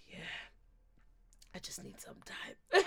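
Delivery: slow, whispered re-enactment; imitated weak reply. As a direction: whisper it slowly, as if drained. Re-enacting her weak reply during labour.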